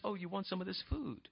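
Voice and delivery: very soft, teasing offer; understated sly tempter; crafty Jacob. Retold or imitated. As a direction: dangle it quietly before him. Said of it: "And Jacob says".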